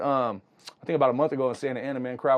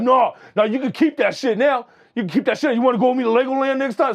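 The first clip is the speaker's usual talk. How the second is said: sarcastic mock offer, pitched up; retold yelling; 9 semitones higher, 4 dB louder than usual. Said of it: "he went big on him".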